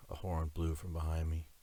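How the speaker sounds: ordinary delivery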